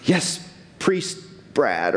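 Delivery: breathy, slow whispered yes, lightly played; half-whispered awed reply to the priest; mock devout congregation. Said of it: "and you're all like".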